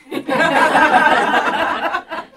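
high, loud cracking laugh; helpless high laugh at the punchline; burst of laughter. Real, not acted. Laughing at the apostle's words.

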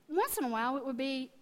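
ordinary delivery

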